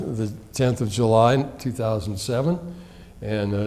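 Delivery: ordinary talk, nothing marked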